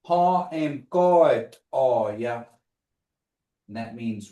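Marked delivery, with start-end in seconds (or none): 0.1-2.5 slow demo. Shown as a demonstration. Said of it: "for those online".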